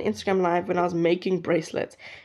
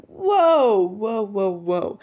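startled whoa; much slower than usual. Retold or imitated. Flashlight shone in face.

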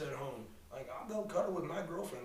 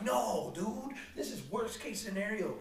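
mock outraged plea; 6 semitones higher, 4 dB louder than usual. Retold plea about a dance partner.

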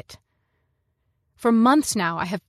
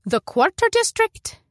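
high, louder mother's voice rising in disbelief; incredulous query; 12 semitones higher, 5 dB louder than usual. A narrator's reading. Mother doubts daughter's plan to refuel there, incredulous. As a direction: question it sharply in a much higher voice.

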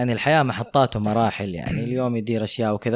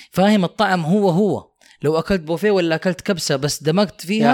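heated insistence; 8 semitones higher than usual. Real, not acted. Heated argument with friend.

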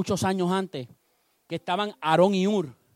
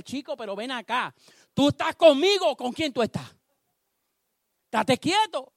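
exasperated scolding; 6 semitones higher than usual. Acted. David reins in Abisai.

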